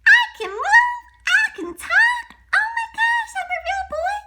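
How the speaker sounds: put-on high boyish delight; excited Pinocchio. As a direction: squeal with joy at being alive. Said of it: "You will now be a real boy".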